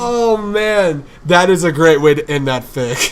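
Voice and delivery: high, very loud excitement; delighted outburst. Real, not acted. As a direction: react loudly, thrilled by the ending. Reacting to the fic's ending.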